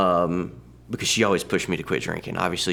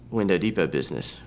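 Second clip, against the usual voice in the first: usual speaking voice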